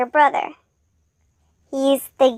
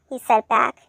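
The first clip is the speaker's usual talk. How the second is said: ordinary delivery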